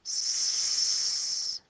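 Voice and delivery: French C with cedilla said as a hissed S; the letter sound s. Shown as a demonstration. Teacher modelling the cedilla sound for children, a crisp S. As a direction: hiss the S sound for ç. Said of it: "It's telling you to go".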